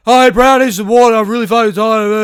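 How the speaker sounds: loud, high mock shout; imitated yell. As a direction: burst in yelling to wake a sleeper. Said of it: "why did you fucking yell?".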